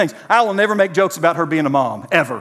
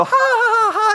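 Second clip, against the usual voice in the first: high mock laugh, a playful chuckle; high, light imitated giggle; imitating wife's laugh. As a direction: laugh like his wife teasing. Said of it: "she'd go".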